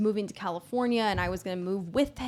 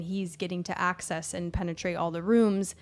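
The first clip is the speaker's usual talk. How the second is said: normal talking voice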